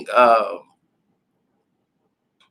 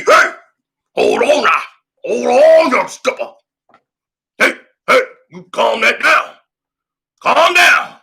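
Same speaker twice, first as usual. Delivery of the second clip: put-on a higher, louder old coach trying to calm things down; old coach calming a player; 7 semitones higher, 7 dB louder than usual. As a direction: play the old coach raising his voice to settle him.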